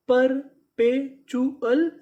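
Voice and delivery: Perpetual stretched slowly, rising at the end; very slow syllable by syllable. Shown as a demonstration. Teacher to students, sounding out the word slowly. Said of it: "तो पूरी meaning".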